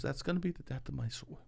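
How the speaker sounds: soft mock foreboding; softly imitated mother. Son quoting his mom's warning, softly imitated mother. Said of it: "She says stuff like this".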